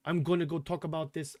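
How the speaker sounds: lightly attempted British accent, softly spoken; badly faked British accent, imitating a TV host; terrible British accent. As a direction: do a half-hearted, bad British accent. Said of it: "that's a terrible British accent".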